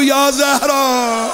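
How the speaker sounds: long, high cry of Ya Zahra; loud call. Real, not acted. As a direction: lead the crowd in a drawn-out cry. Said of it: "بلند بگو".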